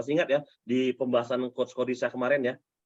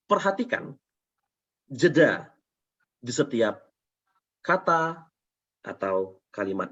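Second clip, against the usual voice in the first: long pauses after "perhatikan", "jeda", "di setiap" and "kata"; deliberate pauses. Shown as a demonstration. Trainer demonstrating jeda, pausing after "perhatikan" and each following chunk. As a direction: break "jeda di setiap kata" into separate pieces with silence between. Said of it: "Kalau jeda seperti ini".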